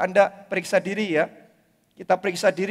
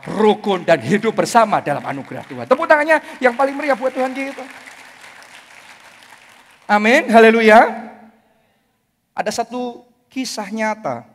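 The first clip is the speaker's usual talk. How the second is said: ordinary delivery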